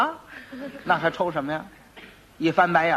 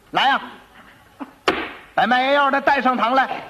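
high, commanding official call; magistrate's summons; 7 semitones higher, 4 dB louder than usual. Acted. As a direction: order the culprit into court, drawing it out. Angry magistrate orders quack brought.

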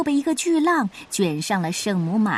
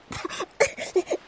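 呛水后的高音咳嗽; 被海水呛得咳起来; 咳嗽声; 9 semitones higher, 3 dB quieter than usual. Acted. 小鸡呛了海水咳嗽. (呛水咳嗽)

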